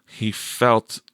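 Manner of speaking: ordinary delivery, nothing marked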